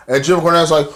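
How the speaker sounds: usual speaking voice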